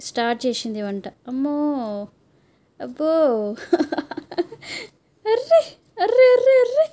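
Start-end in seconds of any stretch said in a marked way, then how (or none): 3.1-4.8 amused laugh; real amused laughter. Real, not acted. Mother amused by child's cooking. 5.3-6.9 high, loud cries of surprise; startled, high-pitched "oh no!"; surprised exclamation. Real, not acted. Exclaims at the playing child.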